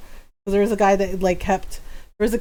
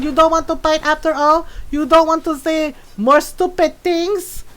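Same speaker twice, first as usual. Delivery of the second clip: high, taunting mom voice; playful imitation of mom's challenge; imitated Filipino mom; 5 semitones higher than usual. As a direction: call them back like a feisty mother. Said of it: "She's like".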